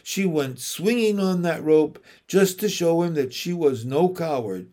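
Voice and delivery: ordinary delivery, nothing marked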